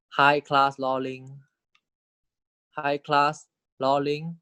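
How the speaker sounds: slow, careful teacher read; very slow dictation. Shown as a demonstration. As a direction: draw out each word for note-taking. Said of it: "dictating term slowly".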